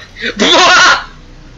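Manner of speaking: éclat de rire aigu; rire aigu éclatant. Real, not acted. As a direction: rit fort, très haut. Rire devant un bug. (fou rire aigu)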